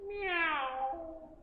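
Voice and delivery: high, soft little meow; kitten meow. Acted. Child as kitten answering grown-up, soft high meow. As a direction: mew like a tiny kitten. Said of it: "And then you'll say".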